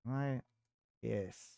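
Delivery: low, soft muttering while searching; muttering to self. Real, not acted. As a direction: mumble softly under your breath, as if thinking aloud.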